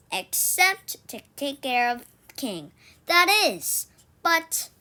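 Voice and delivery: put-on a kid thrilled her plan works; excited. Robin gloating to King about skipping chores, excited.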